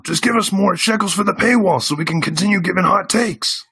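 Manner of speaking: high, strained parody plea; mock money pitch. Parodying hosts begging for paywall money, high mocking voice. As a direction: push the voice way up, mocking a fundraiser.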